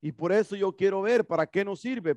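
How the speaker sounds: ordinary talk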